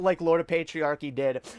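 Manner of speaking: ordinary talk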